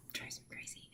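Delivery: the same words again, now a breathless whisper; whispered repeat. Real, not acted. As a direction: drop to a whisper on "It drives me crazy".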